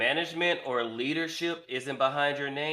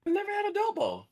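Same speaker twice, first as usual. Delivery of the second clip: very high, squeaky falsetto; squeaky mock voice; 3 dB louder, faster than usual. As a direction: squeak it like a cartoon. Echoes the question mockingly.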